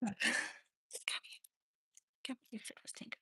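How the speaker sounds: whispered coaxing to the dog; whispering to the dog to come sit. Real, not acted. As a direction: breathe it softly, no voice.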